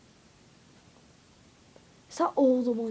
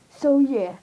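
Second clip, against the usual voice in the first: normal talking voice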